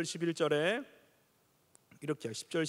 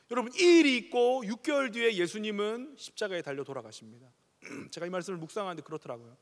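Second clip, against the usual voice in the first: higher, louder pulpit appeal; fervent preaching; 4 semitones higher, 4 dB louder than usual. Acted. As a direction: build the sermon's climax with conviction. Preaching climax.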